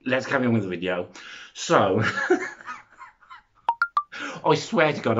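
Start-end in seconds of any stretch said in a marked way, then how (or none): 2.0-5.2 laughing through the words. Real, not acted. Vlogger laughing at her own babbling, laughing out the words. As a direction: giggle while you protest.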